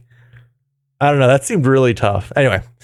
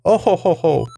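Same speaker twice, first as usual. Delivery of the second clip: villain laugh. Acted.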